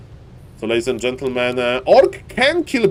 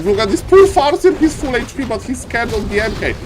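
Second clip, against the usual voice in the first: voicing the Orc player; 7 semitones higher than usual. Caster voicing the Orc player mocking the Farseer, higher mock taunt. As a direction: tease the poor Farseer in a sing-song voice. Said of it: "Orc is like".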